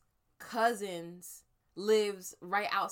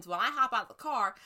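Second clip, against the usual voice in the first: ordinary talk, nothing marked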